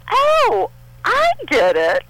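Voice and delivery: high bright oh of discovery; as if it just clicked; sudden realisation. Retold or imitated. Child realising.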